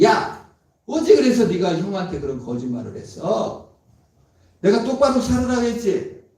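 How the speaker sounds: loud, high scolding rebuke; mock scolding. God scolding Jacob for lying to his brother, loud and high. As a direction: scold him like a stern father. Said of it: "야단 먼저 쳤을지도 몰라요".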